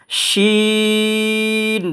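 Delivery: long, intoned "শিন"; three-beat elongation. Shown as a demonstration. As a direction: stretch "শিন" as sheeeen with a spreading sh. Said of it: "তিন আলিফ টেনে পড়বেন".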